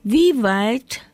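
high, lightly voiced young tubers; curious question. A narrator's reading. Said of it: "fragten die jungen kleinen Knollen neugierig".

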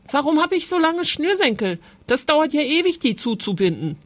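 put-on high, quick boyish whine; complaining. A narrator's reading. Boy complaining to his father about long laces, whining. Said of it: "Franz beschwerte sich".

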